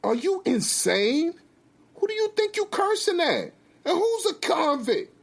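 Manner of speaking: high-pitched indignant challenge; outraged, high-voiced wife; angry wife. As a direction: fire back, voice way up. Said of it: "Lynn gets angry immediately".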